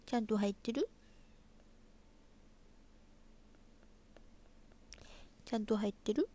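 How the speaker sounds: soft cutesy question with a rising end. Real, not acted. Streamer checking a name is really in there, cutesy. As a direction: ask sweetly, softly, lifting the last syllable. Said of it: "ぶりっ子".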